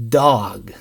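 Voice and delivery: ordinary talk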